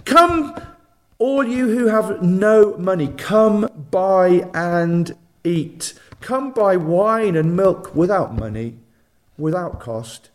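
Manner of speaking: reading Isaiah as God. Acted. Preacher reading God's invitation aloud, emphatic proclamation. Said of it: "He says".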